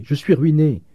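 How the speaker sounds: high, loud lament; distressed exclaiming. A narrator's reading. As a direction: cry out as a ruined landlady. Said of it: "s'écria madame Vauquer".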